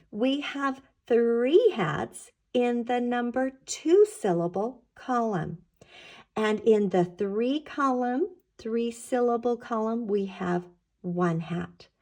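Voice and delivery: normal talking voice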